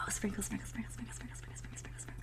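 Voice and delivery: soft whispered sprinkling ritual. Real, not acted.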